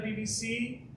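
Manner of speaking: normal talking voice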